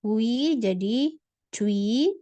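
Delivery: cui drawn out very slowly, c between c and j. Shown as a demonstration. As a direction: spell out cui slowly for learners. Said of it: "contoh lagi misal". (cui diucapkan sangat lambat)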